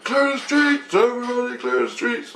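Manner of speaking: high, stretched-out mock call; calling out orders. Jesus ordering everybody off the streets, calling out high. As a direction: imitate a high-voiced call to a crowd. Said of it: "I can hear Jesus going".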